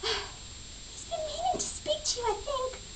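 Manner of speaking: high, soft, drawn-out mimicry of the rival; mock her in a slow, tiny chipmunk squeak; softly squeaked, maddening rival impression; irritating chipmunk voice. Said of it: "She is like a chipmunk".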